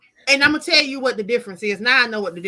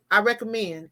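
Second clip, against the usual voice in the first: ordinary delivery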